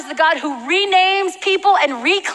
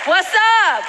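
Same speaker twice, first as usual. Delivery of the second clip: drawn-out, higher-pitched call to the crowd; hyped shout-out; 5 semitones higher, slower than usual. Real, not acted. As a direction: holler out to your home street. Hollering back to crowd member.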